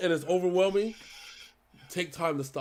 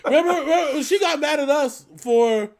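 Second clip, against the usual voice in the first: loud, high, laughing outburst; real laughter pushing the voice up high; laughing recollection; 11 semitones higher, 9 dB louder than usual. Real, not acted. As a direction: burst out laughing as you recall it. Laughing memory of her scolding them.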